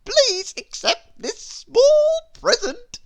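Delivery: high, loud and slow, overly sweet; high, sly offer. A narrator's reading. Mister Mischief offering Miss Wise a parcel, high and slow. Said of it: "he said to her".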